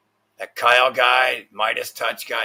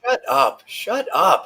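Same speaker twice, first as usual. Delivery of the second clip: sharp, much higher shut up at the screen; annoyed high snap; 9 semitones higher, 5 dB louder, slower than usual. Real, not acted. Streamer snapping at a video that won't pause, high and annoyed. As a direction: yell at the video to stop.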